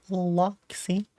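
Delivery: high, breathy whisper. Shown as a demonstration. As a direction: whisper the name softly. Repeats name in a whisper.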